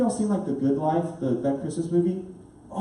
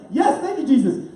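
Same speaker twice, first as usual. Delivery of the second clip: high, delighted exclamation; elated thanks; 7 semitones higher, 3 dB louder than usual. Retold or imitated. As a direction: rejoice at an answered prayer. Grateful exclamation to Jesus.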